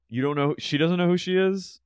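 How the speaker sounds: ordinary talk, nothing marked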